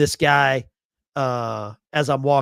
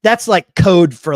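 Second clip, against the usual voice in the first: mock exasperated; 5 semitones higher, 12 dB louder, slower than usual. Retold reaction to a veteran remark.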